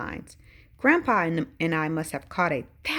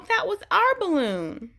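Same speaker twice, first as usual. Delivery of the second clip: higher and slow, still crying out; slow anguished yell; 12 semitones higher, 3 dB louder than usual. A narrator's reading. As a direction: drag out the hurt shout. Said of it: "I yell".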